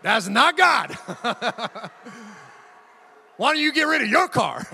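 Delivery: loud, laughing, hammed-up protest; loud comic indignation through laughter; mock outrage. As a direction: shout it like a joking denial. Mock outrage at wife's suggestion.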